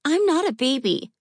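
put-on high little girl, grumbling and pouting; grumbling child. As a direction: grumble like an offended kid. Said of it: "she grumbled".

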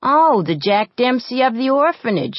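high, loud sarcastic jab; mocking. A narrator's reading. Girl mocks the bully as a boxer, high loud sarcasm. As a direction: taunt her with mock praise.

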